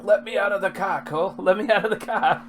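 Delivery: high, loud mock Aussie plea; high pleading mock accent, laughing by the end; imitating Nicole Kidman's accent. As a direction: ham up the girl from Days of Thunder. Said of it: "she's like".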